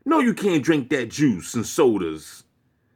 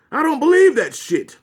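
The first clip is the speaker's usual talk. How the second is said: mock indignant shout; 11 semitones higher, 6 dB louder than usual. Mock believer's outburst.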